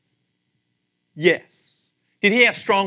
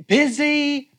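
mock busy; 10 semitones higher, 6 dB louder than usual. Mocking Baal as busy.

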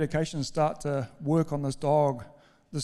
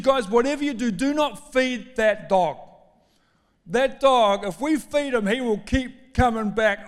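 emphatic, higher-pitched dad laying down the law; stern father's order; 6 semitones higher, 4 dB louder than usual. Retold or imitated. Father lays down rule to kids.

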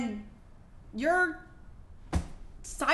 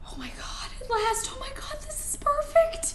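much higher, thrilled squeal; delighted. Acted. Wife opens husband's gift, thrilled and much higher. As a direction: gush with delight.

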